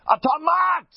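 high, loud yell. Retold or imitated. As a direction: shout up at the worker. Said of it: "He yells at him".

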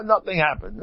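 high-pitched mock innocence; acted denial. Toilet-goer closing the door, denying it in a high voice.

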